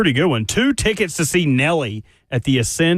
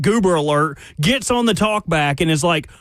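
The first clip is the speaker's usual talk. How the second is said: normal talking voice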